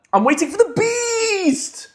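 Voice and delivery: high, slow, stretched-out build; hammed-up hype. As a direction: draw the last word out high like a hype man. Hypes his awaited signing.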